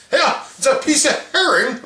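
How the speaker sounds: high, put-on voice of the food court clerk. Food court clerk explaining herring, high put-on voice. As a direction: chirp it like a cheerful clerk.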